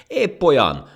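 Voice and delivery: very high, loud, angry retort; furious. Acted. Ant insisting to the laughing monkey, furious. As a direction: fire back, stung by mockery. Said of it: "mondta dühösen a hangya".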